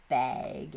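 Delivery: "bag" with a drawn-out Midwest vowel, like "bayg". Shown as a demonstration. Showing viewers her accent, Midwest "bag" said as "bayg". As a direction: stretch "bag" toward "bayg". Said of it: "I say things like".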